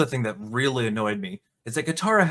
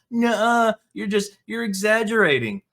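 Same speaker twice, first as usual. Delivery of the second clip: much higher, bratty Nuh-uh; mocking whiny commenter; 8 semitones higher, 4 dB louder than usual. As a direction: sneer it like a sulky troll. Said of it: "weirdos saying".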